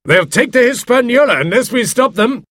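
high-pitched shouted warning; urgent cry. A narrator's reading. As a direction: spur them on in alarm. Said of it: "cried out Doctor Livesey".

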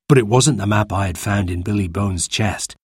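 ordinary delivery, nothing marked

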